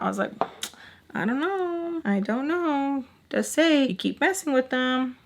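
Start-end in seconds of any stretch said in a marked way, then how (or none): 1.1-5.2 higher, drawn-out mock innocence; singsong needling, pitched up; teasing. Taunting Sam about what is coming, teasing. Teasing her with feigned ignorance.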